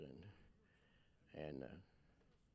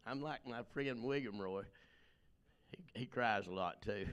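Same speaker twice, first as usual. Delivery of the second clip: normal talking voice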